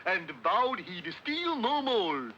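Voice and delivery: dopey moose carrying on with his verse; goofy poem recital. Acted. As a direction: plod back into the rhyme, then declaim it. Bullwinkle recites the nursery rhyme.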